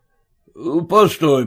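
plain reading voice

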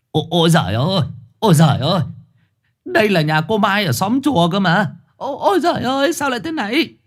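put-on high, frantic old man's stammer; an old man sees a neighbour's severed head, shrill panic; panicked old man. A narrator's reading.

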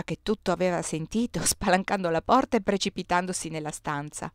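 ordinary delivery

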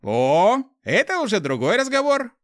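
high, loud, gleeful exclamation; light up at the sudden cooperation; delighted. A narrator's reading. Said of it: "обрадовался Руди". (обрадованно, громко и высоко)

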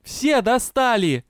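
high, loud, slowed-down outburst; furious yelling. A narrator's reading. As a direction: holler each word in exasperation. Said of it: "орала вампирша".

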